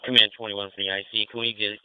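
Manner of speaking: normal talking voice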